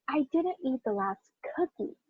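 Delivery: soft, with "cookie" set apart and prominent; stress on COOKIE. Shown as a demonstration. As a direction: save the emphasis for "cookie". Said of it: "what about if I do".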